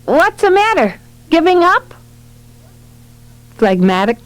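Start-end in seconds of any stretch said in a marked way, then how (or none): 0.0-1.9 put-on high-pitched child piping up; piping little boy. A narrator's reading. Johnny sees mother removing cream, pipes up in high child voice. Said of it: "Johnny piped up".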